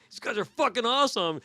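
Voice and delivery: loud, high-pitched thrilled exclamation; wowed, voice jumping up; excited awe. Retold or imitated. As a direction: recreate gushing like an amazed fan. Retelling his reaction to fire stunt.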